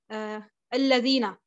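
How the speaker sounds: ordinary talk, nothing marked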